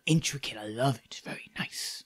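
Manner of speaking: whispered, admiring the fine clothes; host admiring a guest's garb in a whisper; hushed admiring whisper. Acted. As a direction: breathe the compliment out in a whisper. Maddox admiring the stranger's garb.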